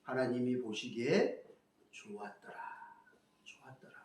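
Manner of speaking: fading to a soft near whisper; hushed scripture. Retold or imitated. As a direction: let it fall to a reverent hush. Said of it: "이렇게 얘기하시죠". (경건하게 점점 작아지는 속삭임)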